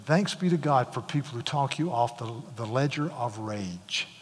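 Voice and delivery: ordinary delivery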